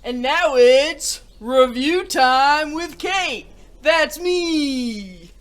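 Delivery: loud, high, slow announcer-style hello; bright show-host announcement; excited host intro. Real, not acted. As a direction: sell the segment title big.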